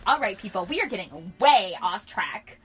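loud, firm group address; exasperated calling to order. A narrator's reading. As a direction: project to rein everyone in. Narrator character calls group to order.

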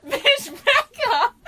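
high, loud laughing yell; laughing outcry. Real, not acted. Friend yelling at the reader through laughter.

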